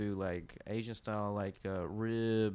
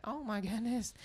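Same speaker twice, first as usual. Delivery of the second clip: country mom; 12 semitones higher than usual. Retold or imitated. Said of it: "Really country, you know, like that".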